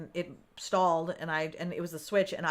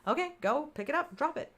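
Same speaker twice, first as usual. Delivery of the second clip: higher, coaxing self instructions; self coaching; 6 semitones higher, 4 dB quieter than usual. Retold or imitated. To herself, coaching the Switch fix, higher pitch. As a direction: coax yourself step by step. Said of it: "I coached myself".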